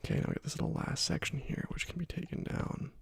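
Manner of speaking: ordinary talk, nothing marked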